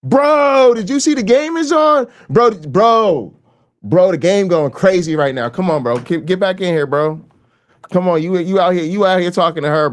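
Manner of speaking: high, loud calls of "bro"; calling a friend. Shown as a demonstration. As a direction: yell for your buddy to come back in. Acts friend calling him back.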